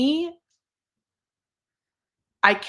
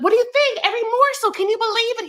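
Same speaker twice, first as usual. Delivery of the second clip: much higher and louder, thrilled announcement; excited squeal; 13 semitones higher, 4 dB louder, faster than usual. Retold or imitated. Wife announcing her husband's arrival, high excited squeal. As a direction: squeal it like you can't contain yourself. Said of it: "She's like".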